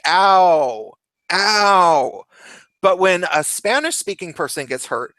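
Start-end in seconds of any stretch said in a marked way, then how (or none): 0.0-2.2 mock pained ow, ow; an American's yelp of pain, imitated; a typical American ouch; American saying ow. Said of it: "they generally say".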